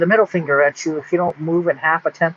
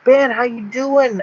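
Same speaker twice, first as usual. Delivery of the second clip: higher, drawn-out New York greeting; friendly New Yorker; 7 semitones higher than usual. Acted. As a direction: greet a pal, lightly imitated New York style. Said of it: "some people that know me from YouTube".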